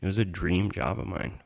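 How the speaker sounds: normal talking voice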